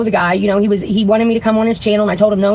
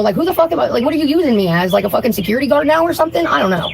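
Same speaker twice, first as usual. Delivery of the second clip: ordinary talk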